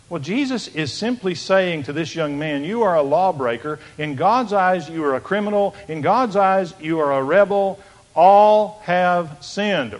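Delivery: fervent preaching. Acted. Preaching at full force.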